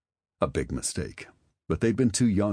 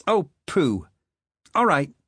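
high, louder pouting give-in; sulk, then give in; a much higher voice, pouting; pouty Marley voice; 12 semitones higher, 6 dB louder than usual. Acted.

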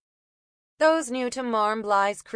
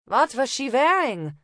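put-on higher, German-accented question; 6 semitones higher, 5 dB louder than usual. Una questioning the copper, German accent. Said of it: "feigning a German accent".

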